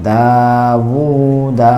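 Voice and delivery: slow Quranic syllable drawl; elongated recitation. Shown as a demonstration. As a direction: stretch both long vowels in দাউদা. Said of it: "দুই জায়গায় টান হবে".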